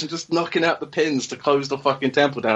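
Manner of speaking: ordinary talk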